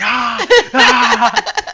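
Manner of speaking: loud high caveman yells; caveman roar. Retold or imitated. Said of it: "I go all caveman".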